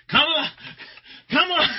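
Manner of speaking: high, loud calling out. Retold or imitated. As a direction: shout the name across the room. Confused president calling out.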